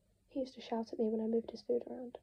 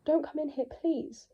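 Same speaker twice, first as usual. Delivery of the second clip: higher, loud, desperate begging; panicked plea; 4 semitones higher, 7 dB louder, slower than usual. Acted. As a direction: beg her to stay out.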